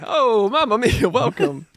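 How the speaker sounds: slow, high sing-song Italian welcome; hammed-up mamma mia greeting; booming sing song welcome. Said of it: "She's like".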